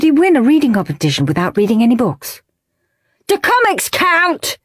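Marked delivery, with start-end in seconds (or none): 3.3-4.6 high hopeful shout. Acted. Ralph shouting question to teacher, hopeful. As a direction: call the question out. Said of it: "shouted Rude Ralph".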